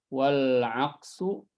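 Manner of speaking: drawn-out dictation chant; writing it on the board; slow recitation. Shown as a demonstration. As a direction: spell the verse out slowly. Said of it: "dictating verse while writing".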